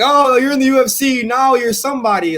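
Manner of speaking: high, loud mock sneer; mocking impression of a shallow fan; mocking snob. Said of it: "'cause they're like".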